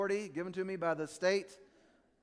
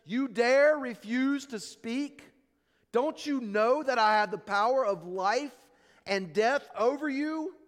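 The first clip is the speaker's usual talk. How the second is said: Pilate demanding; 5 semitones higher, 5 dB louder than usual. Retold or imitated. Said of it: "Pilate says".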